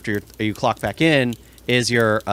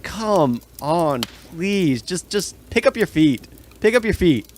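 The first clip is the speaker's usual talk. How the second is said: higher-pitched, pleading urging; exasperated plea; 6 semitones higher, slower than usual. Acted. Worker stuck behind a slow employee, exasperated pleading. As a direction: beg them to hurry up.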